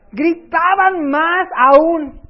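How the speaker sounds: preacher nearly shouting the verse; loud, drawn out. Acted. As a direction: stretch and belt out each word. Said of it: "me gustaría gritar ahorita".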